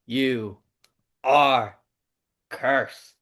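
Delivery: lightly voiced wrestler's curse, slow and paused; Danhausen. Co-host quoting a wrestler's curse at listeners, lightly imitated. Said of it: "in the words of Danhausen".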